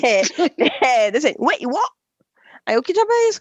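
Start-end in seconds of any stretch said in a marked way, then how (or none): 1.4-1.9 put-on English accent Portuguese. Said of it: "como é que o cara fala?". 2.8-3.4 shocked scolding. Retold or imitated. Mother reacting to son.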